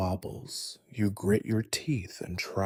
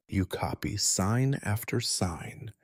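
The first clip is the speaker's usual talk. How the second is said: usual speaking voice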